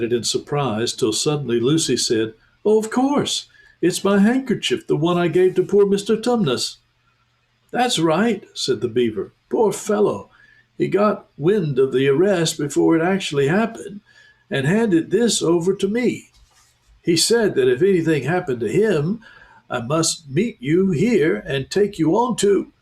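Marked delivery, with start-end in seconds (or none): 2.6-6.8 put-on higher, bright child's exclamation; suddenly remembering the handkerchief; surprised recognition. 7.7-22.7 anxious beaver. Acted. Beaver recounts Tumnus' arrest.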